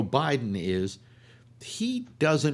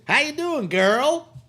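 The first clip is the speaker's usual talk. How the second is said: high, loud sassy greeting; sassy Oprah voice; 5 dB louder, slower than usual. Retold or imitated. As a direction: play up the sass, way high. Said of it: "the next day it's".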